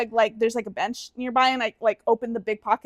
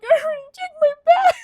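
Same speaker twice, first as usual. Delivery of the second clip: high, loud, wailing imitation of crying; mock crying; 5 dB louder than usual. Retelling her tearful answer to the airport worker, mock crying wail. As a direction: blubber it in a squeaky exaggerated sob. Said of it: "this girl's crying".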